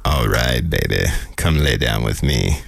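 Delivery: deep, slow sultry soul voice. Pretend. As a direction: croon it low like a seductive soul singer.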